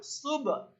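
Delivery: high-pitched সুব with a bouncing final ব; সুব, final consonant pushed out; qalqalah bounce. Shown as a demonstration. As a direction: let the b in সুব pop with a short echo. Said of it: "এই যে ধাক্কা দিলাম".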